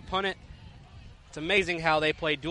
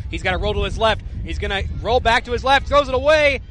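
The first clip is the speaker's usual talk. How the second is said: excited; 4 semitones higher, 6 dB louder than usual. Real, not acted. Play-by-play of scramble and throwaway.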